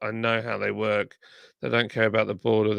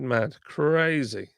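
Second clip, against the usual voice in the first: ordinary talk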